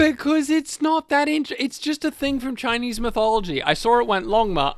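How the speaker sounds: high, insistent protest; exasperated. Real, not acted. Defending the skipped Longma to a friend, exasperated.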